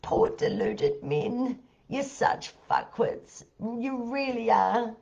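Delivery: soft, slow, nearly whispered scorn; scornful mutter. Real, not acted. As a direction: mutter it under your breath, shaking your head. Muttered jab at deluded men.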